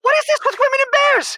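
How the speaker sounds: very high, loud indignant rant; loud, high-pitched exasperation; exasperated outburst. Acted. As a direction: throw up his hands and yell it. Husband storms off exasperated.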